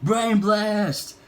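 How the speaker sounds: high, excited brain blast; Jimmy struck by an idea, excited high cry; sudden cry. A narrator's reading. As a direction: burst out with the idea. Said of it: "Jimmy suddenly cried".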